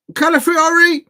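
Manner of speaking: high, loud shout of the name; excited call. Real, not acted. Commentating the Arsenal match live, loud excited call. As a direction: call the player out like a goal.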